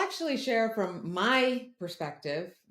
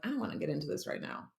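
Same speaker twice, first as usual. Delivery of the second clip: softer, fast inner voice; inner reluctance; 5 semitones lower, 8 dB quieter, faster than usual. Retold or imitated. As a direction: mutter it to yourself, not wanting to talk. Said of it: "everything inside me was like".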